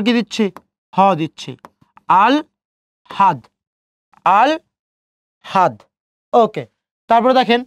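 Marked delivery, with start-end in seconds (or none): 2.2-5.7 আল ... হাদ with a clear gap, l first, then h; syllable-by-syllable pronunciation. Shown as a demonstration. Teacher applying the l-then-h rule, syllable-by-syllable pronunciation. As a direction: break it into "al", pause, "had". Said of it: "প্রথমে ল দিচ্ছি".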